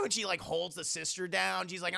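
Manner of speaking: ordinary talk, nothing marked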